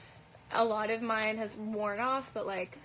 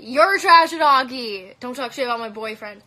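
outraged yell; 15 dB louder than usual. Real, not acted. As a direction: shout it back at him, offended. Yelling back at a live commenter.

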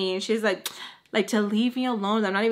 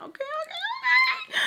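high laughing squeal; laughing shout. Retold or imitated. Laughing shout in retold quote.

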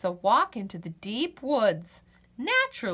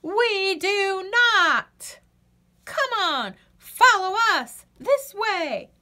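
much higher, loud, slow proud reply; rescuing friends; 9 semitones higher, 5 dB louder, slower than usual. Acted. The animal friends rescuing Birdie, high and loud. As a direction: answer proudly then urge her along.

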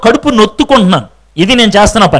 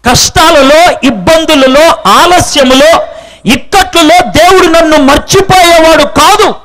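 raised preaching voice, building; 4 semitones higher than usual. Acted. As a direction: proclaim it to the congregation. Preaching climax. (ఉత్సాహంగా ప్రసంగం)

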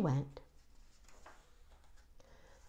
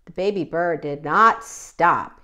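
plain reading voice

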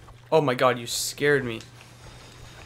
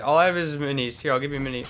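normal talking voice

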